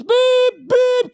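bleep it out like a censor tone; censor beep. Pretend. Bleeping out swear words in mock outrage.

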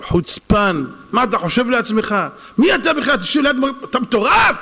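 indignant retold scolding, rising in pitch; angry yelling. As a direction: act out an outraged telling-off, building to a shout. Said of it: "זה התחיל לצעוק עליו".

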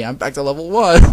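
loud wail climbing to the end; outraged, rising exclamation; loud dismay. A narrator's reading. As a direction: cry out the defeat. First take of Konata's defeat line.

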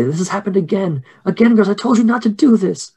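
high, agitated scolding; distraught telling-off, pitched well up; upset mother. Acted. Mary scolds her girls for repeating it, high upset voice. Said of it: "you can hear her say".

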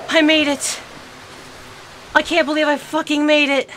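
high, loud and fast relief; relieved excitement. Real, not acted. Player surviving the night, breathless loud relief. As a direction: burst out with disbelieving joy. Escaped danger in game.

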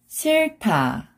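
싫다 joined as 실타, aspirated t; read together. Shown as a demonstration. Teacher shows how it reads together, linked pronunciation. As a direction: run 싫다 into one word with an airy 타. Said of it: "When you read it together".

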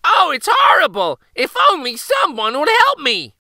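loud, high, hammed-up plea; crying out in fake distress. A narrator's reading. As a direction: wail for help as the prankster boy. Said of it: "he cried".

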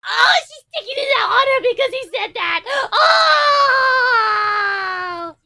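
fast, high, loud laughing outburst; laughing shout. Real, not acted. Fan shrieking with laughter at the teasing scene. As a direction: burst out laughing in delight.

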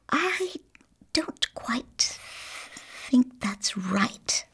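soft, slow, breathy hush; embarrassed whisper. A narrator's reading. Embarrassed Missus Millefleur whispering to the children. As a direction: admit it under your breath. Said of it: "she whispered".

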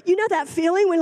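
ordinary delivery

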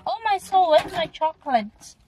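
hammed-up shock at the missing snack; dramatic high alarm. Real, not acted. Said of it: "what a dramatic intro".

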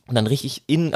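ordinary talk, nothing marked

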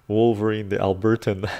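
hammed-up grand introduction; mock announcer. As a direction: present him like a ring announcer.

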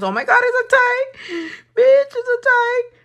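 giddy high-pitched shriek; excited squeal. Real, not acted. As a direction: squeal with disbelief at the tie. Poll turns out tied.

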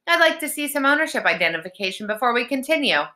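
high, fast officious request; sceptical demand. A narrator's reading. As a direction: rattle off a huffy demand. Connor challenges troll's ownership.

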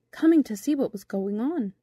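usual speaking voice

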